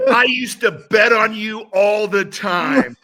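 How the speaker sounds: high, loud, drawn-out shout; retold yelling. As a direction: holler it slowly, mimicking an excited fan. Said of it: "he starts yelling".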